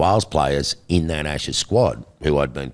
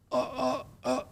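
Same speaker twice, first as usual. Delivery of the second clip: flustered surprise; 10 dB quieter than usual. Retold or imitated. Shocked to see the captain.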